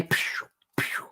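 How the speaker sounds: whispered zap sound effect; breathy mock laser noise; whispered pew pew. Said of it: "zapping draining people away".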